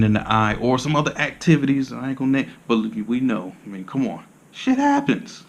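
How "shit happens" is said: playful high-pitched shrug; squeaky high shrug. Acted. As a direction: jump way higher for a comic shit happens. Comic shrug about eye mishaps.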